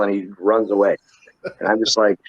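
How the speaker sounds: ordinary talk, nothing marked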